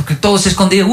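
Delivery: usual speaking voice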